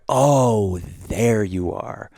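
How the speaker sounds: loud drawn-out "oh", then settling slowly; acted discovery, stretched out in recognition; delighted recognition. Hiker greeting the forest's boss tree on spotting it, drawn-out recognition.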